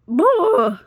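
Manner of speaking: loud high exasperated noise; exasperated blurt. Real, not acted. Fed up with Amanda, a loud exasperated blurt. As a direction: throw up your hands with a sound.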